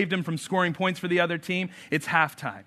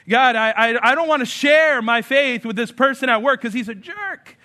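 loud, higher whiny excuse to God; mock reluctant believer; 7 semitones higher, 8 dB louder than usual. Pastor voicing a believer refusing to share faith, whiny and loud. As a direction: whine your excuse to God loudly.

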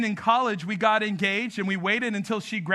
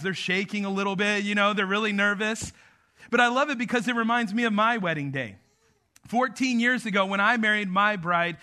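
ordinary talk, nothing marked

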